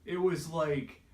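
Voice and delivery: slow, voice breaking at the start; holding back real tears while remembering; choked up. Real, not acted. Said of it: "I might even start crying right now".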